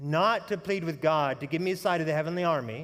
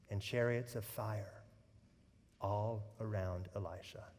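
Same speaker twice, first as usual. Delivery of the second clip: hushed preaching; 7 semitones lower, 10 dB quieter than usual. Acted. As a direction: draw the congregation in quietly. Quiet awed sermon passage.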